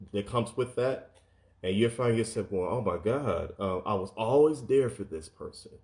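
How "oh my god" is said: normal talking voice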